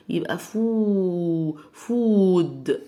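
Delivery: فود with a long stretched oo; long oo demo. Shown as a demonstration. Teacher showing students the double o sound. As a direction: drag out the oo in فود slowly. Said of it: "بنطقها او".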